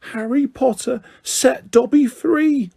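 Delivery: slow, high grateful elf. Acted. Dobby grateful.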